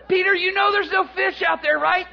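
high, pressing shout; heckling disbelief. Acted. Fishermen to Peter about the empty lake, incredulous shouting. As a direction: call it out as if he's lost it. Acts buddies heckling from shore.

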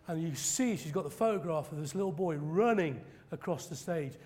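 normal talking voice

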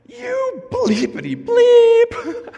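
put-on high, loud, slow little kid yelling a curse; kid cursing. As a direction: squeal it like a small angry boy. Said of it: "I hear a little voice go".

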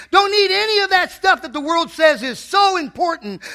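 preaching at full force. Acted. Preacher at the deathbed, crying out to the church. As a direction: hammer each line home.

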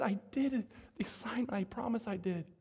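hushed plea, fading to a near whisper; holding back tears. Retold or imitated. Little boy defends himself to teacher, holding back tears. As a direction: swallow the tears as you insist. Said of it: "trying to hold the tears back".

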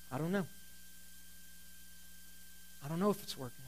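quiet and somber, the voice sinking; somber, unsure. Retold or imitated. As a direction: let the words fall away softly in doubt. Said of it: "he got this real somber look on his face".

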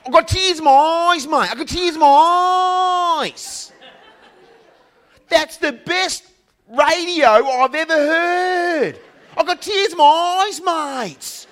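high, loud, slow, overwhelmed bloke on the phone, shouting by the end; emotional Aussie trucker. Retold or imitated. Caller praising the radio show to Brett, loud and high gushing trucker. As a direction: gush like a choked-up trucker who loved the show. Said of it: "it sounded like this".

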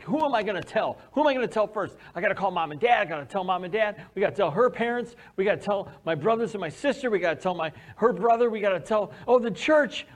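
eagerly running through who to call; excited list. Retold or imitated. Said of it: "I was so excited".

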